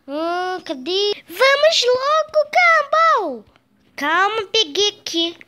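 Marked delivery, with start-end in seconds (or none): 1.3-3.6 impatient shout. Acted. Impatient calling Gumball.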